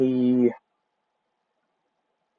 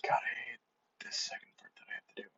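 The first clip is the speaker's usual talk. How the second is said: muttered whisper; 20 dB quieter, much faster than usual. Real, not acted. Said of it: "unvoiced whisper to self".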